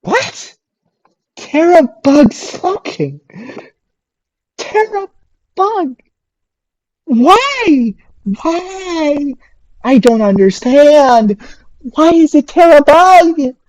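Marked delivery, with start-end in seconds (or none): 0.0-9.3 high, confused questioning; baffled disbelief. Real, not acted. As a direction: ask like it makes no sense. Baffled at enemy pick.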